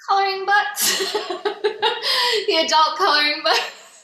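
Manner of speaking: laughing through the words. Real, not acted. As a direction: laugh it out, high and loud. Laughing punchline reveal.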